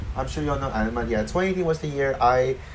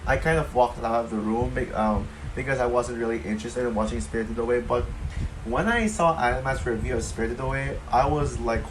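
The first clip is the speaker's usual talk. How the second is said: ordinary talk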